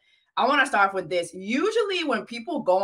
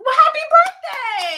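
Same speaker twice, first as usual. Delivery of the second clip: higher, louder burst of cheer; excited birthday wish; 7 dB louder than usual. Real, not acted. Host congratulating the guest on turning thirty-four, excited. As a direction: sing it out bright and delighted.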